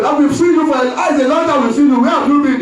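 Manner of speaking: a hint of a fussing church lady; preacher mimicking the church woman welcoming him back, high and mock-effusive; church woman greeting. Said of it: "And the African church woman say".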